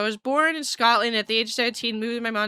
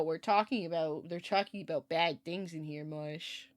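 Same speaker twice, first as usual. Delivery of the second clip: hushed to cat; 4 semitones lower, 9 dB quieter than usual. Real, not acted. Murmuring to cat.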